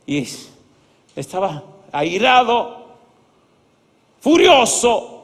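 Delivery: ordinary talk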